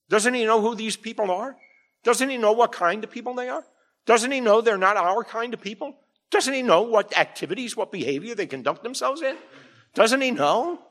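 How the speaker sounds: voicing the Pharisees' indignant whispers about Jesus, high and scandalised; mock grumbling Pharisees. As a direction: sneer at Jesus's company like a gossiping Pharisee. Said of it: "they're over there mumbling, grumbling".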